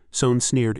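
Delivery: plain reading voice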